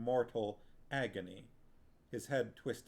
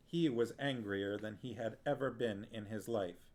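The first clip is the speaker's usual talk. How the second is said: ordinary delivery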